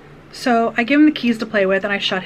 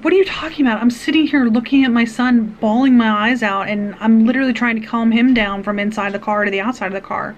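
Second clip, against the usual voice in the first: raised, louder disbelief, easing down; indignant retort; 4 dB louder than usual. Retold or imitated. Distraught mother to police officer, retold indignant protest. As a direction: re-enact an upset parent's protest.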